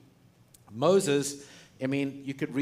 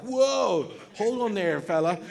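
Moses pulling up, much higher and louder; hammed-up Moses saying whoa; mock Moses; 7 semitones higher, 5 dB louder than usual. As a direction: yank the reins, calming a friend. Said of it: "Moses is like".

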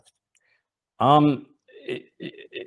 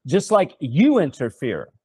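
ordinary talk

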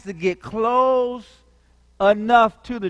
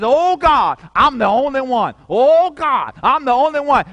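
louder, higher panicked plea; Elijah's wail; 5 semitones higher, 6 dB louder than usual. Acted. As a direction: cry out to God in despair. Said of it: "runs up under a juniper tree and says".

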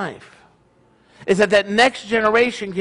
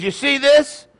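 high, rising mock-lecturing question; pointed question; 8 semitones higher than usual. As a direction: play a father showing his son a lesson. Joke father makes his point.

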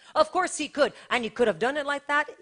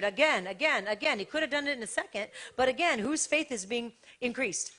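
usual speaking voice

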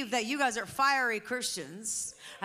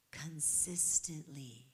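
"consistently" drawn out slowly, low and hushed; "consistently" said softly, very slowly, with weight; deliberate emphasis; 7 semitones lower, 8 dB quieter, much slower than usual. Real, not acted. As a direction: lean on "consistently" by stretching every syllable. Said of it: "I am emphasizing that word on purpose".

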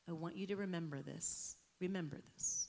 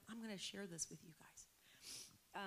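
whispered aside; 10 dB quieter, slower than usual. Real, not acted. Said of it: "has nothing to do with the message".

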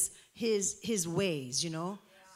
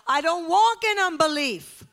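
loud, high declaration; full-force preaching; 8 semitones higher, 7 dB louder than usual. Real, not acted. Preacher declaring faith to the church, loud and high. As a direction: proclaim it to the rafters.

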